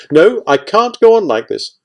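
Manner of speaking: high, loud, exasperated refusal; heated refusal. A narrator's reading. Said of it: "about to get extremely rude".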